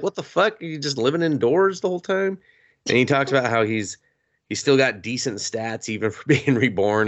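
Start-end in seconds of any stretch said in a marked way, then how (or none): 0.0-2.4 high, disbelieving what the fuck; mock shock at a shut-in; incredulous. Viewer reacting to the hermit character, high and incredulous.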